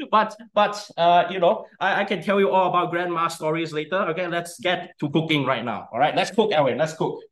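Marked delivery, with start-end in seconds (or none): none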